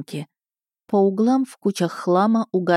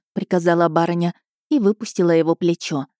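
ordinary delivery

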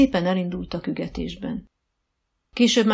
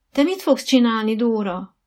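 higher, louder anxious question; mildly fretful, raised pitch; worried question; 5 semitones higher, 8 dB louder than usual. A narrator's reading. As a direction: ask with a touch of worry. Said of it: "aggodalmaskodott Dorka".

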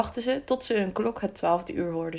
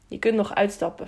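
usual speaking voice

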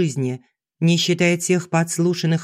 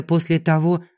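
usual speaking voice

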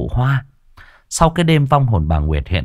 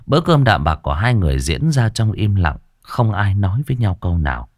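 ordinary delivery

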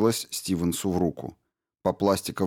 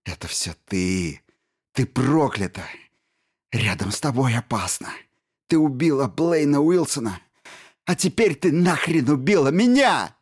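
muttering building to a scream; 6 semitones higher, 4 dB louder than usual. A narrator's reading. Stevens accuses Jensen, mutter rising to furious shout.

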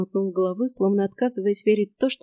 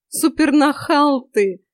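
laughing; 5 semitones higher, 6 dB louder, slower than usual. A narrator's reading. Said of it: "рассмеялась я".

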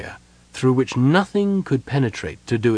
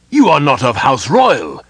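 louder, higher brusque objection; rising brusque protest, louder and higher; 6 semitones higher, 6 dB louder than usual. A narrator's reading. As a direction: cut him down, curt and loud. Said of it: "he stated brusquely".

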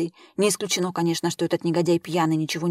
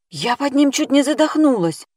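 higher, louder complaining woman; 8 semitones higher, 5 dB louder than usual. A narrator's reading. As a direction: complain breathlessly after the struggle. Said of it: "пожаловалась Эльвира".

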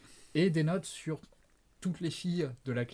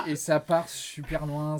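ordinary talk

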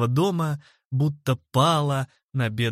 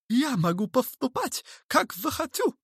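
very high-pitched, huffy voice; cutting retort; 11 semitones higher than usual. A narrator's reading. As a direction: snub him with petulant authority. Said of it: "отрезал мистер Хорспот". (резко отрезать)

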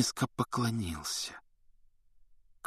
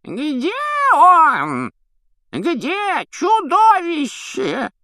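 put-on high, slow screeching old man; shrill falsetto old man; 7 dB louder, much slower than usual. A narrator's reading. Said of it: "визгливый фальцет дряхлого".

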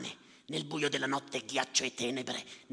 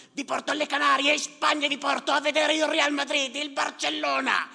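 much higher, louder bravado; fake courage boast; 13 semitones higher, 6 dB louder than usual. Yusuf reassuring passengers, loud bravado. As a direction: sing out the promise to hide fear. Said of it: "fa finta di aver coraggio".

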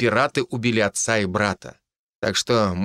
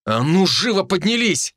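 barked order; 8 semitones higher than usual. A narrator's reading. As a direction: bark them to their feet. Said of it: "рявкнул я".

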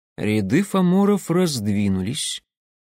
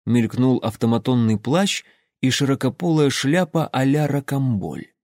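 plain reading voice

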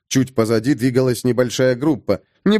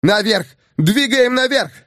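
yelling orders; 8 semitones higher than usual. Acted. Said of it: "заорал Кирсон".

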